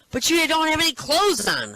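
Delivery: high, loud indignant yell; husband yelling at the naked man in the bathroom. Acted. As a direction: holler it at the naked stranger. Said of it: "And the husband yells".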